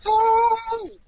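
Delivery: high, loud drawn-out "hey"; retold yelling. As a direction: holler after someone to stop them. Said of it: "I was yelling".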